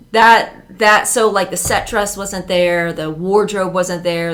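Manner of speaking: ordinary delivery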